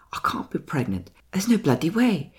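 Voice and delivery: put-on posh, near South African English accent, jumping up high; Shaw's posh accent. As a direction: mimic a not-quite-English, posh hybrid accent. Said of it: "trying to mirror what she's doing".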